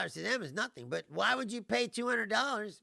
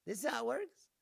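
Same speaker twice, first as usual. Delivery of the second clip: higher, incredulous questions, mock astonished; retold disbelief; 7 semitones higher than usual. Reacting to the bank's rules, incredulous and high. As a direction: act out being stunned by the bank. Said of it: "I was sitting there like".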